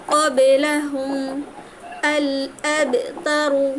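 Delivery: slow recitation, with the ক in মা কো তান bounced; slow qalqalah recitation. Shown as a demonstration. Teacher recites Quran words slowly for students. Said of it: "কলকলা করে পড়তে হবে".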